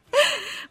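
short high giggle; quick burst of giggling; light laugh. Real, not acted. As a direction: give a brief high-pitched laugh. Amused at her slang word.

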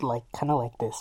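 muffled behind cupped hands; hand over mouth. Shown as a demonstration. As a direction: talk through your hands over the mic. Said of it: "where I'm putting my hands in front of the mic".

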